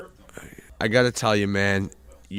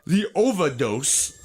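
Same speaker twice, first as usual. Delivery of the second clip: ordinary delivery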